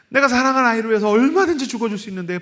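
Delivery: 얼마든지 pushed out fast and high through a laugh; laughing, fast and high, stress on 얼마든지. Acted. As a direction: rush it with a laugh, 얼마든지 on top. Laughing rephrase of the line.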